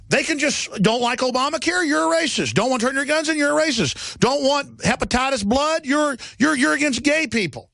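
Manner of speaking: high, sing-out mock accusations; sarcastic, high-pitched finger-pointing. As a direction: parrot the accusers, pitched well up. Mimicking accusations of bigotry.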